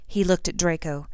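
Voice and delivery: ordinary delivery, nothing marked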